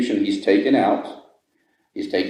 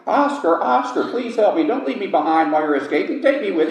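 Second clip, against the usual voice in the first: mock scream; 10 semitones higher than usual. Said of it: "starts screaming to the top of his lungs".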